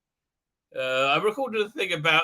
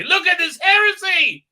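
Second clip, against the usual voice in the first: high, loud mock-outraged shriek; crazy fans; 11 semitones higher, 9 dB louder than usual. Crazy fans outraged at an article, shrieking mock outrage. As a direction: cry heresy like a frenzied zealot. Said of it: "crazy Jodie fans share these things".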